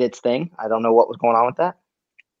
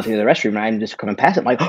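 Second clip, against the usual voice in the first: normal talking voice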